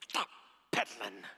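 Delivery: halting, words forced out one at a time; mock angry child. Mad little son admits his mistake to dad, angry. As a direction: imitate a mad boy's grudging confession. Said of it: "he was just mad".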